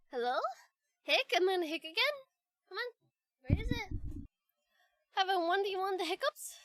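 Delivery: ordinary talk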